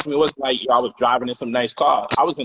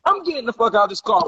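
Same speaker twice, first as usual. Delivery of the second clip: storming out; 6 semitones higher, faster than usual. Retold or imitated. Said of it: "she in her bag".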